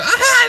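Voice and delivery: very high, loud mock shriek; shrill scream. Raging girl in the dome, very high loud shriek. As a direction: let out a piercing scream. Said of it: "she goes".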